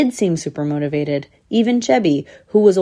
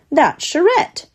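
high-pitched little pup voice; high kiddie squirrel; young squirrel pup; 10 semitones higher, 3 dB louder, slower than usual. A narrator's reading. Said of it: "said one of the pups".

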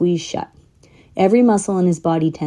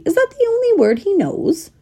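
irritated. A narrator's reading. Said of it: "Jed said irritably".

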